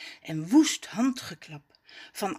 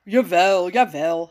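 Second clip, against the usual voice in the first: lower, loud, placating figure voice; hasty soothing; 3 semitones lower, 7 dB louder than usual. A narrator's reading. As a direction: hurry to calm someone offended. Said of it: "haast Rommes zich Jacques te kalmeren". (haastig sussend, met lagere stem)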